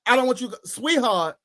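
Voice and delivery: heated argument. Retold or imitated. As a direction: tell her off with rising heat. Heated retold phone argument.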